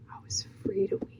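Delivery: quick, very soft whisper; hushed, voiceless and moved; emotional whisper. Real, not acted. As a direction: whisper it, overcome with feeling. Overcome by her own testimony.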